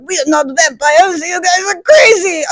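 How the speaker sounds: high, slow put-on voice; protesting vampires. Accused vampires answer the grandmother, slow high mock protest. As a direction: drag out a hammed-up denial.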